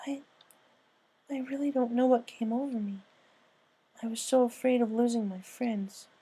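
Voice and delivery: a shaky-toned confession, lightly voiced; Pinkie, admitting fear. Pinky admits her fear.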